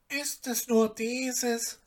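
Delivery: a gentle helper reassuring the dwarf; mild knowing question; calm goose. Acted. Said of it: "sprach sie".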